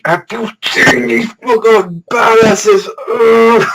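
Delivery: high, loud fan outburst; uncontrolled hype. Real, not acted. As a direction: squeal it out, losing control. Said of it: "I've gotta control myself".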